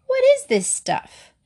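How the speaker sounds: high-pitched, appalled question; disgusted outcry. A narrator's reading. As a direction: demand it right after spitting out the drink. Girl spits out foul drink.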